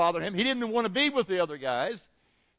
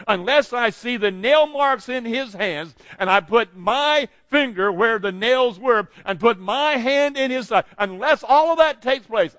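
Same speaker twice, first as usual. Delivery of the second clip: loud, higher, defiant demand; angry; 4 semitones higher, 7 dB louder than usual. Acted. Thomas setting his terms to the disciples, angry. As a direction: lay down conditions forcefully, stressing each one. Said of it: "hear the anger in his voice".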